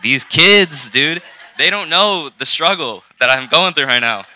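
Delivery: high, loud, drawn-out exasperation; retold upset. Speaker venting about kids who got tongues first, retold upset. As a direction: play up the frustrated complaint about the kids. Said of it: "I was really upset".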